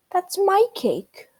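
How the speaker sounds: high, squeaky little mouse voice; a small mouse's high squeak. Acted. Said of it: "squeaked Mouse".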